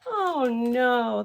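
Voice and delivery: ordinary delivery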